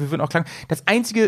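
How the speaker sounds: ordinary delivery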